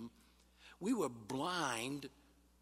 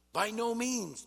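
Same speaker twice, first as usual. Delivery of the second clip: emphatic quote; 10 semitones higher, 5 dB louder than usual. Acted. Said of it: "what Paul's saying here is".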